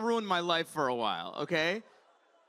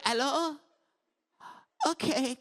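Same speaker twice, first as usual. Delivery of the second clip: put-on high-pitched, accented old grandma; grandma on voicemail; 7 semitones higher than usual. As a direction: play a confused grandmother leaving a message. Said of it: "Every voicemail she leaves me sounds the same".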